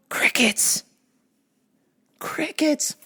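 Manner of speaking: hushed, voiceless whisper into silence; whispered crickets. Retold or imitated. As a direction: whisper it into the dead air of a phone call. Mocking silence on the phone line.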